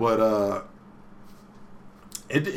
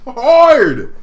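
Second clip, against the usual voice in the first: much higher, louder, slow and drawn out; emphatic loud; 11 dB louder, much slower than usual. Real, not acted. Telling his cohost how hard she roasted Haseo, slow and loud. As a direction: stretch every word for emphasis.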